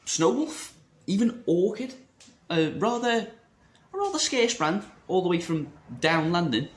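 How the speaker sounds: put-on a cheeky, half-hearted Cockney accent; jokey Cockney accent shouting out brands; mock Cockney accent. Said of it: "that was my version of a Cockney accent".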